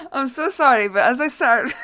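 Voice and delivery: giggly sorry; laughing apology. Real, not acted. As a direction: apologise while still laughing. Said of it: "I just started to laugh".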